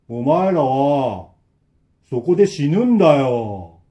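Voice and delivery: very slow, loud menacing pronouncement; sinister threat. Acted. Old man tells the trapped three they will die, menacing. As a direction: draw it out like a curse.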